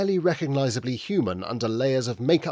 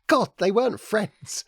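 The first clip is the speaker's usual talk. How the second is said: laughing; 9 semitones higher than usual. A narrator's reading. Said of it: "laughed Indigo".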